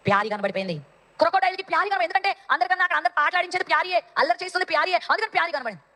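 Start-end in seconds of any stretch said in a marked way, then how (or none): none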